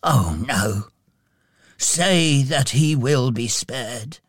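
mildly distressed, drawn-out plea; pleading. A narrator's reading. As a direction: beg slowly with a touch of dismay. Scrooge pleads for the child.

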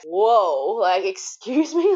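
drawn-out, high-pitched retold indignation; affronted disbelief. New mum reacts to midwife's rudeness, affronted disbelief. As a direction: stretch it out, incredulous, with a laugh.

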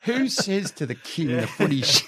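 high-pitched, laughing through the words; laughing in disbelief. Real, not acted. Storyteller amazed anyone said that to the king, laughing. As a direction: crack up at your own punchline. Storyteller cracks up at his anecdote.

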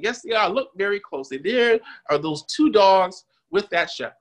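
mock Scottish lilt from an old teacher; thick Scottish accent. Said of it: "in his thick Scottish accent".

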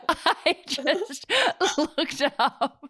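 high, wheezing words through laughter; laughing too hard to get it out; helpless laughter. Real, not acted. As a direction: try to speak while cracking up. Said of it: "I can't even say it".

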